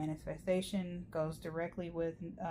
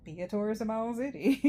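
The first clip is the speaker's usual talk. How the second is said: excited; 5 semitones higher, 7 dB louder than usual. Real, not acted. Excited about touring her city.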